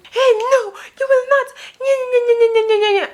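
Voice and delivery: very high nonsense wailing; mock crying woman. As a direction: mimic her sobbing as squeaky babble. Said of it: "as she's busy crying".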